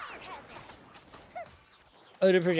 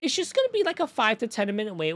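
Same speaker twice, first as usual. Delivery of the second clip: mock coworker voice; 9 semitones higher than usual. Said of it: "And he was like".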